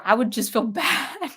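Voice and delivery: real laugh breaking into the words. Real, not acted. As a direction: admit it through a laugh. Laughs while admitting crying.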